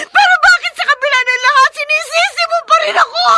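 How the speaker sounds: tearful shouting. Acted. Wife crying and shouting at her husband who blames her. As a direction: scream the hurt at him through tears.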